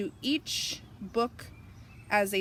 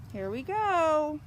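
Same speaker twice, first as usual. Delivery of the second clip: plain reading voice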